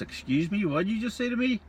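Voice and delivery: high, shocked "excuse me"; an offended barmaid, voice shooting up in disbelief; indignant barmaid. Acted. Barmaid offended by crude remark.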